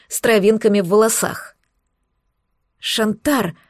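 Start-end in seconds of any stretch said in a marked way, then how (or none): 2.8-3.6 a mild sob on the name; understated tearful cry; sobbing name. A narrator's reading. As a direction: call his name through tears. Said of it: "всхлипнула Даяна".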